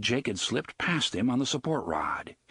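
plain reading voice

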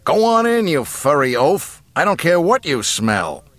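high, loud exasperated order; yelling. A narrator's reading. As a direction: yell at a stubborn companion. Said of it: "Solo yelled".

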